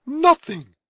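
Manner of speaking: high, loud, firm woman's voice; firm reply. A narrator's reading. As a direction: cut him short, resolute. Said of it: "was the firm reply".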